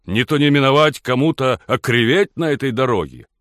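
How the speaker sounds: high, loud threatening rebuke; angry warning. A narrator's reading. Blacksmith to the gentleman driver, loud angry warning. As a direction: warn him off forcefully.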